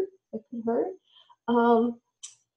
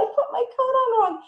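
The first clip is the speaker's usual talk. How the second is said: much higher, louder mock dismay; hammed-up embarrassment; 6 dB louder than usual. Shown as a demonstration. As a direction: overplay the embarrassment for the child. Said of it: "You can model it".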